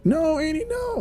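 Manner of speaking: very high, wailing no; mock anguished wail; anguished cry. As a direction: cry out for the lost friend. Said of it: "bad Jean's like".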